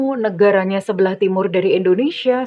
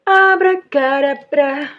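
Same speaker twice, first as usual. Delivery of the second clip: slow, high, loud magic word; kids party magician; 5 semitones higher, 5 dB louder, much slower than usual. Pretend. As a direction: stretch it out like a stage magician. Performs a magic spell.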